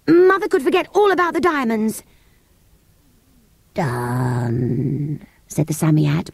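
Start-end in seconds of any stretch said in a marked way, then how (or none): 0.1-2.0 put-on high-pitched, eager girl; sudden wish. A narrator's reading. Girl jumping in with a wish, sudden and high. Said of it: "said Jane suddenly". 3.7-5.2 low, long-drawn, fading word; weaker voice. A narrator's reading. Creature worn out by granting wishes, weak and drawn out. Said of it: "but its voice was weaker".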